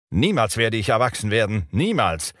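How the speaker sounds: high defiant shout. Acted. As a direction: scream it at Hook. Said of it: "schrie dabei".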